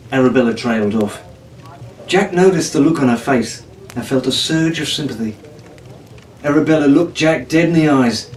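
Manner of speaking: ordinary delivery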